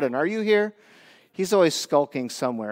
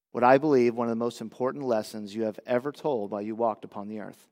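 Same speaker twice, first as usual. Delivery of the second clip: soft, reverent prayer voice; 6 semitones lower, 6 dB quieter than usual. Acted. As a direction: pray quietly to the Father. Opening prayer.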